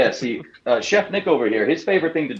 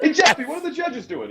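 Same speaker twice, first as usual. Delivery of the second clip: high mock dad calling. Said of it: "he just send Jeffy over".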